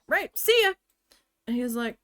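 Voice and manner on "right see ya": breezy brush-off. Retold or imitated. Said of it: "I'm like".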